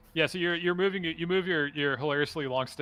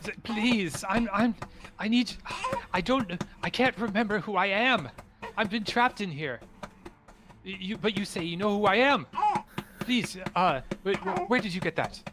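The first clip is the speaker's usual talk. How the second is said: higher, halting, broken phrases; desperate pleading; 7 semitones higher than usual. Acted. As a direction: stammer like a lost, trapped man. Trapped amnesiac begs for help.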